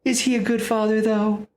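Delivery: high, mocking challenge; mocking taunt. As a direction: sneer it up high, taunting the son. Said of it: "And he goes".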